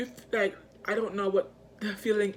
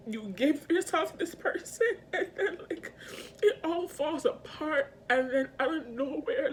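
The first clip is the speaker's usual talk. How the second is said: high, breaking into tears; real tearful crying; 7 semitones higher than usual. Real, not acted. Gave all to this person, tearful and hurt. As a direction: talk through the crying, pitch climbing. Crying while describing being let down.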